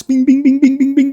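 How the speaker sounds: high, rapid-fire mouth-made bings; imitated alert pings. Message alerts flooding in mid-game, high mimicked pinging.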